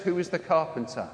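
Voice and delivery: ordinary talk, nothing marked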